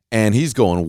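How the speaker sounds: usual speaking voice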